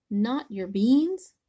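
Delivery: slow, loud outraged question; shocked. Acted. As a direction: gasp it out in disbelief.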